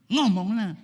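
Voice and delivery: high, loud demand to speak up; nagging wife. Acted. Wife badgering her silent husband, loud and shrill-pitched.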